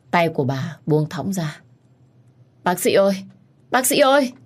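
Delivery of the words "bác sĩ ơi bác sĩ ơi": much higher, louder shout for the doctor; frantic call. Acted. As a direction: scream for help down the corridor. Calling the doctor in panic. (gào gọi bác sĩ, cao và to)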